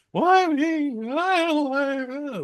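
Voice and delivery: mock a helpless damsel whining; whiny helpless girl. Reviewer mocks a passive captive girl, high whiny voice. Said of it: "sitting on her ass going".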